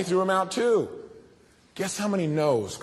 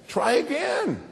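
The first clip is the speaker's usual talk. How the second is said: higher, drawn-out encouragement; patient parent; 8 semitones higher, slower than usual. Retold or imitated. Parent urging the kid on, higher and drawn out. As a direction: stretch it out higher, gently insisting.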